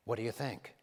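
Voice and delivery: imitating Reagan, low and soft; hushed, folksy Reagan impression; Ronald Reagan. Reagan confiding to the comedian about his impressions, low and soft. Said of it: "he says to me".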